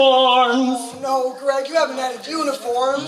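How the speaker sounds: dismayed. Acted. Partner dismayed by added uniforms.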